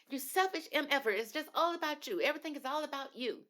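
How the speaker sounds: high, indignant scolding of a selfish partner; shrill up-high tirade, imitating Courtney; high-pitched mock fury at a selfish partner; mock outraged scolding. Courtney lashing out at Nick.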